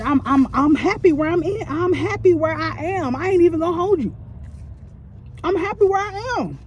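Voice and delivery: high, mocking sing-song of fake contentment; a mild mock cheerful sign-off to the boss; sarcastic dismissal. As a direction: brush the boss off with a mocking lilt. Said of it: "And I'm like".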